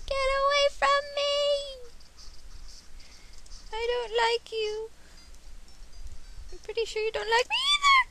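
very high, squeaky pleading; squealed protest. Real, not acted. Player telling an enemy to get away, squealed protest.

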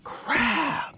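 high-pitched exasperated complaint; real dismay, voice shooting up; frustrated outburst. Real, not acted. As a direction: cry out as the catch gets away. Loses the fish just hooked.

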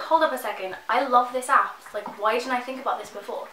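usual speaking voice

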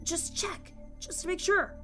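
high, soft hushed voice; hushed, coaxing half-whisper; Dash whispering. Acted. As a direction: urge it under your breath. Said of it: "Dash whispered".

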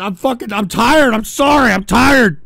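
normal talking voice

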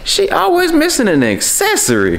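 mocking high voice. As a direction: tease her in a high pitched voice. Repeats line in mocking voice.

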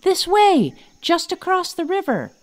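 high, loud yell over the shoulder; yelling back. Acted. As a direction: shout it back while swinging away. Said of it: "yelled back".

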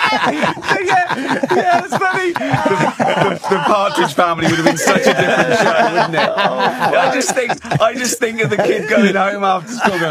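high, cracking up while talking; real helpless giggling under the speech; laughing. Real, not acted. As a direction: push the words out through laughter. Cracking up at the shocking story.

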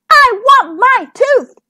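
high, loud, drawn-out demand; crying out. A narrator's reading. Little princess whose tooth has disappeared, crying out. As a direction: wail it word by word. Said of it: "cried the little princess".

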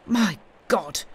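exclaimed with a touch of shock; shocked cry. A narrator's reading. Cobbler seeing the smith approach, shocked cry. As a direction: cry out, mildly aghast. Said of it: "cried the atheistic cobbler".

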